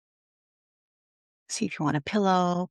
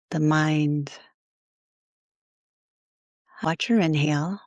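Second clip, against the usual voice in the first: guided meditation. Acted. Yoga teacher guiding listeners to let go of their day, calm guided meditation. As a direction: ease them into rest, phrase by phrase.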